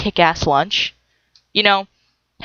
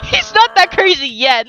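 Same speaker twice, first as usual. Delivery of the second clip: higher, laughing through the words; 5 semitones higher than usual. Real, not acted. Gamer repeating her joke about the sim, laughing. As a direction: giggle it out as you repeat the joke.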